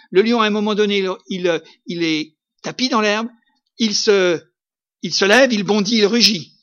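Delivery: loud, rising sermon voice about the roaring lion; preaching at full force. Acted. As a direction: hammer the terror of the roar home to the congregation. Preaches the lion's attack. (prédication à pleine voix, de plus en plus forte)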